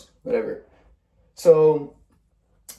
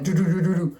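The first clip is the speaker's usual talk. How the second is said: high little "do-do-do" for scurrying feet; imitated running noise; 3 semitones higher, faster than usual. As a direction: rattle off syllables like scampering steps. Imitates her running steps.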